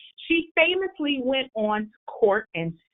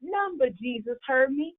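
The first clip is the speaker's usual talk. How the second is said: Sojourner Truth speech in dialect. Acted. Sojourner Truth addressing the women's rights convention, Southern dialect oration. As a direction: deliver the speech in a Southern dialect like a nineteenth-century orator. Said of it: "she spoke in that manner".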